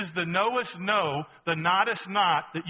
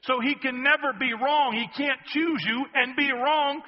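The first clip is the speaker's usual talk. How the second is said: normal talking voice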